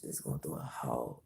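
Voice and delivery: normal talking voice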